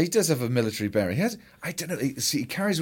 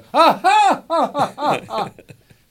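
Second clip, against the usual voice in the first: genuinely threatening villain laugh; loud high laugh meant to menace; unhinged villain; 11 dB louder than usual. Pretend. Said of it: "Oh, threatening. All right, here we go".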